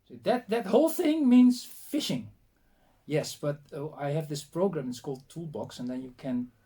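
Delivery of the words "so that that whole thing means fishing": high, loud astonished check; incredulous. Retold or imitated. Researcher querying a woman's translation, high and loudly incredulous. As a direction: question it in a raised, disbelieving voice. Retold disbelief at long word.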